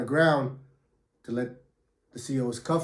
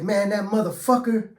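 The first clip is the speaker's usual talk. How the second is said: angry; 7 semitones higher than usual. Retold or imitated. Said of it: "It's like".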